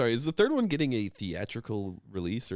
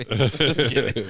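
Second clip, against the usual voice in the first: laughed out, much louder; laughing; 8 dB louder, slower than usual. Shown as a demonstration. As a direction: burst out laughing on it.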